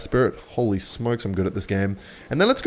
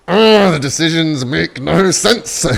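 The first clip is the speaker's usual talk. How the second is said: loud, high, drawn-out wail of disbelief; exasperated outburst; 6 semitones higher, 8 dB louder, slower than usual. Real, not acted. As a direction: cry out slowly in loud exasperation. Outburst at opponent's baffling move.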